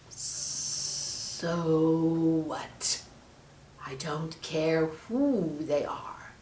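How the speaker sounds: slow, soft snake hiss. Acted. Snake defying the angel in a slow hiss. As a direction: spit it out as a serpent. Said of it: "hissed the snake".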